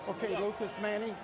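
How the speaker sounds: ordinary delivery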